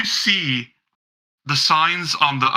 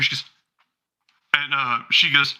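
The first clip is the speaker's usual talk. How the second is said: ordinary talk, nothing marked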